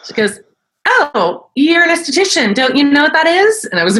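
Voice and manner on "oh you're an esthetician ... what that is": high, bubbly mock voice; imitating a bubbly friend, much higher; imitating the girl. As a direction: play the chirpy girl up high. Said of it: "she said it just like that".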